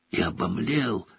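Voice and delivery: usual speaking voice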